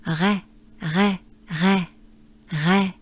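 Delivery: "Re" com R gutural após boa respiração; French re, breath first. Shown as a demonstration. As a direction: respire fundo e solte "Re". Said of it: "take a good breath first".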